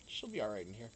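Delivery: ordinary talk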